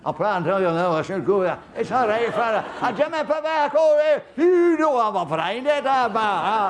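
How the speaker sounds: hammed-up loud Glasgow shipyard voice, slowing at the end; loud Glaswegian accent. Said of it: "I thought they were talking a different language".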